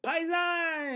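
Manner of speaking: high mock Italian accent; Italian greeting. Teller greeting the owner back, mock Italian accent. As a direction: toss it off like an Italian paisan. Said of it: "and I said".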